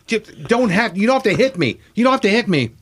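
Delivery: high-pitched, urgent mock plea; imitating a frantic negotiation; retold pleading. Retold pleading with attacking brother.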